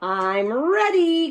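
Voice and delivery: high, slow eager call; eager cry. Acted. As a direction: announce it proudly. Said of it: "cried Froggy".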